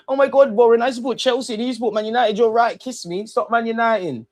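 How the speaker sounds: high, sugary mock fan voice; teasing falsetto fan; mock gushing fan. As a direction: camp it up like a lovestruck rival. Mocking rival fans gushing.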